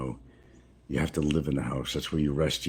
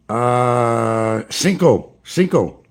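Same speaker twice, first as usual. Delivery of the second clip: much higher and louder count in a put-on Filipino accent; 7 semitones higher, 9 dB louder, much slower than usual. Telling the shopkeeper how many sacks in a high, loud put-on Filipino accent. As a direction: answer the count like a local. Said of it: "gotta put the Filipino accent on".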